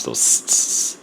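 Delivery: whispered tss showing harsh sibilance; sibilant hiss demo. Shown as a demonstration. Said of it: "like, tss and the ps".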